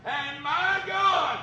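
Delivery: slow, loud, overwhelmed exclamation; awed cry. Acted. Thomas falling down crying out to the Lord. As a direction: cry it out in awe. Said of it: "He fell down and said".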